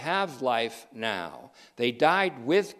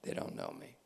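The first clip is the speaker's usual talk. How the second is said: whisper; 15 dB quieter, faster than usual. Acted. God's reply repeated as a whisper.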